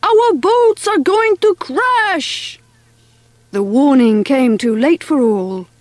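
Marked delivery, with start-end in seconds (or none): 0.0-2.6 slow, high, alarmed French shout; yelling. A narrator's reading. French mice warning of a crash, yelling. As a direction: stretch out the high warning cry. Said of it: "yelled the French".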